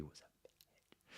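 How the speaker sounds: slow, breathy whispered insult; slow whispered jab about the lady; whispered aside. Real, not acted. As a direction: say it like a secret aside.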